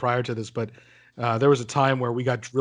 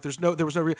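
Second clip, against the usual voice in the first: usual speaking voice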